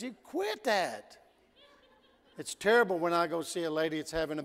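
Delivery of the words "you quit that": wife's scolding. Retold or imitated. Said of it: "She says".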